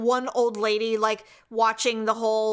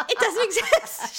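slower, through laughter; laughing repeat; 10 semitones higher, 4 dB louder, slower than usual. Retold or imitated. Repeating the supervisor's verdict, slowly and laughing. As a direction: drag it out, giggling.

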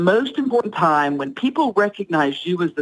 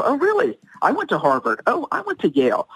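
mock impressed, jumping up high; a listener suddenly won over; 8 semitones higher than usual. Audience member hearing the credentials, mock impressed.

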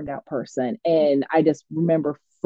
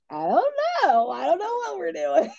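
high, laughing helplessness; high helpless laughing answer; 10 semitones higher than usual. Retold or imitated. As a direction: throw up your hands with a high laugh. Helpless reply to worried husband.

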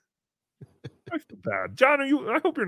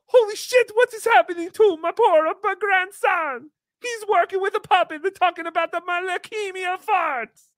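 put-on high-pitched, louder shocked old lady; grandma voice; 12 semitones higher, 4 dB louder than usual. Dead grandma looking down on her grandson, high and shocked. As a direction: squeal it like a horrified grandma. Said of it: "she must be looking down and going".

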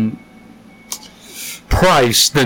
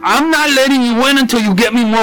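put-on girlfriend refusing; 8 semitones higher than usual. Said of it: "she goes".

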